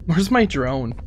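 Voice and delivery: ordinary talk, nothing marked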